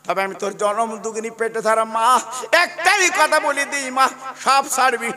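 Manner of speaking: loud, high chanted lament; the mother sends her son off, half singing; tearful blessing chanted to the congregation; preached mother's blessing. Acted.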